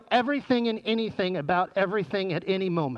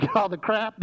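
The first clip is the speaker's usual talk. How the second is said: ordinary talk, nothing marked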